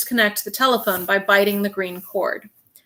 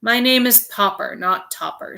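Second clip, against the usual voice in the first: usual speaking voice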